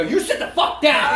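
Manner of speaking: high, loud barked order, laughing; retold yell. Wrestler ordering a trainee to sit down, loud yelled command. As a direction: shout him back into his seat. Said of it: "I go".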